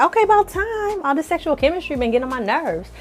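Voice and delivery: high-pitched mock exasperation; exasperated relief. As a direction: pitch jumps up in an impatient, finally-it-happened reaction. Recapper's own exasperated reaction to kiss.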